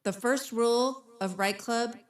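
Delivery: slow, solemn club-rules announcement; mock announcer. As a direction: intone the rules with measured weight.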